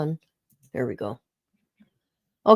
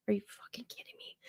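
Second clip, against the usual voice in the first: fast incredulous whisper; whispered disbelief; 16 dB quieter, faster than usual. Real, not acted. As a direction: hiss it in disbelief. Disbelief aside.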